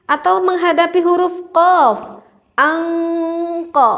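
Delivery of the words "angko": angko with a back ng before k; correct ikhfa before qaf. Shown as a demonstration. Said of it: "baru itu tepat".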